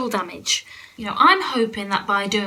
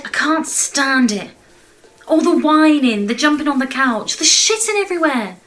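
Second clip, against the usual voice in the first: exasperated outburst; 3 semitones higher, 6 dB louder than usual. Acted. Mother telling off her family about the mess, exasperated rant. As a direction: let your exasperation boil over. Ranting at family over the chaos.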